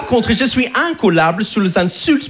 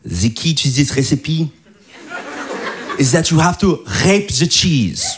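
put-on French accent English; 5 semitones lower than usual. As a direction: imitate his French partner mangling English. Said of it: "Elle m'a dit". (accent français exagéré en anglais)

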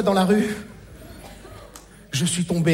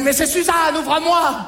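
beaucoup plus aigu, appelé fort; l'extraterrestre déguisé en mère appelle; mère qui appelle; 8 semitones higher than usual. Acted. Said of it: "il m'a dit". (appel aigu de mère imitée)

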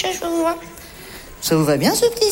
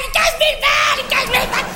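high, frantic mock shrieking; imitating hysterical boss; 11 semitones higher, faster than usual. Employee mimicking furious boss over stolen money, hysterical. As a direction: mimic someone losing it, fast and high-pitched. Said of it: "sur un mode complètement hystérique".

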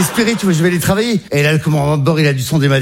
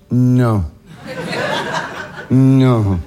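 dread; 6 semitones lower than usual. Retold or imitated. Dread seeing mother arrive.

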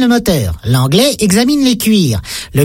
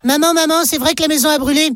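put-on child voice; 6 semitones higher than usual. Child asking mother.

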